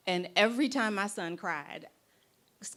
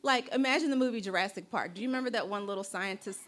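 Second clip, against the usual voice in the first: ordinary talk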